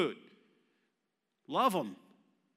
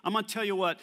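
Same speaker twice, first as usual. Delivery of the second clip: usual speaking voice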